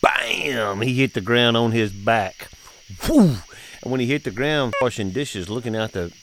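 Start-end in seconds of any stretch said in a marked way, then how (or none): 3.0-3.4 high, loud whew of the fall; mock gasp at the crash. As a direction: blurt a sharp high whew. Imitating fall impact.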